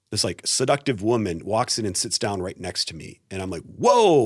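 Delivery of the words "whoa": sudden high, loud whoa; startled whoa. Retold or imitated. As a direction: jump back in surprise. Startled by seductive woman in dream.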